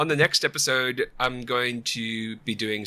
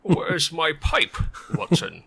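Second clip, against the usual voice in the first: slow, high, hammed-up German Holmes; bad German Sherlock Holmes impression; mock German accent; 5 semitones higher, slower than usual. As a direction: drag out the question in a fake German accent. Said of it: "it sounded German".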